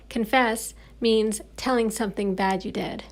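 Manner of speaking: plain reading voice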